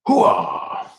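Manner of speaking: full-throated, drawn-out hooah; Pacino impression. Actor going full tilt on his catchphrase, Pacino impression. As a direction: let out a hoarse movie-star holler. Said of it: "just go full Pacino".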